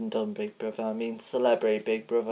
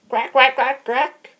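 very high, loud quacking; excited duck quacks; 12 semitones higher, 8 dB louder than usual. Pretend. Mister Duckface quacking happily at the host, very high.

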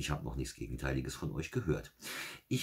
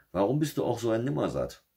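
scolding fox; 9 semitones higher, 6 dB louder than usual. Acted. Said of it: "Der Fuchs antwortete".

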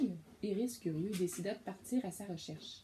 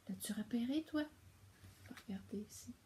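whisper; 3 semitones higher, 7 dB quieter, faster than usual. Real, not acted. Parent shows the child the feather, whispering. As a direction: breathe it like a secret. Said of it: "whispering about the feather".

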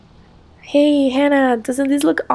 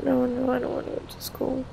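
whispered, far-off sleep talk; sleeping boy whispering a nightmare; 11 dB quieter than usual. Acted. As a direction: whisper it dreaming. Child talks in sleep.

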